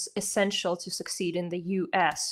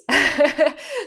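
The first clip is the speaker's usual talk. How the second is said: a short high laugh; light laugh; 7 semitones higher, 6 dB louder than usual. Real, not acted. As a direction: let a laugh rise up. Laughs while summarizing.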